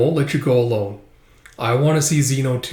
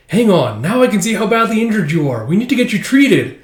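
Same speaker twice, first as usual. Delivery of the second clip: alarmed concern; 7 semitones higher, 5 dB louder than usual. Acted. Girl alarmed by his injuries.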